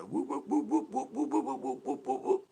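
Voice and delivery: high whooping siren noise; siren imitation. Mocking the cops' sirens, whooping siren voice. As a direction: mimic a police siren whooping. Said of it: "Why do they always do that with their sirens".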